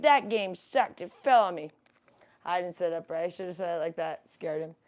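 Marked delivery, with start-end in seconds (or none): 0.0-1.7 louder, lightly voiced grumpy pet; voicing the bunny. Voicing her bunny's grumble about the toppled game, mildly grumpy. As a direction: grumble for the rabbit. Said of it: "He's like".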